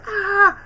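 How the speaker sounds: high, loud crying scream; a sharp crying yell; anguished scream. Retold or imitated. Gamer imitating Peter screaming over dying Harry. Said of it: "when Peter screams where he goes".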